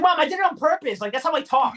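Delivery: high-pitched, defensive retort to a parent; mock-indignant whine; exasperated protest. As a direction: plead your case to mom. Acted protest to correcting mother.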